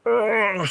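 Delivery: high disgusted groan; frustrated groan. Real, not acted. Groan at the loss.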